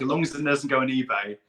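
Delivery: ordinary delivery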